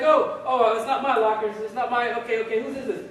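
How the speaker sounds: high, flustered stammer; panicked recruit. Acted. Recruit finding an open locker, mock panic.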